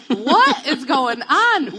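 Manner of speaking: loud, slow, high cry of disbelief; shocked outburst. Retold or imitated. Wife reacting to her husband's baby idea, loud slow disbelief. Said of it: "I'm like".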